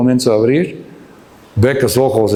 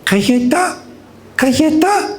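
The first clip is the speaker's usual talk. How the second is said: put-on high, sweet little-girl plea; tiny child's rising request; sweet toddler voice; 14 semitones higher than usual. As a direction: imitate a toddler asking sweetly, pitched up. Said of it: "con su voz tan dulce dice".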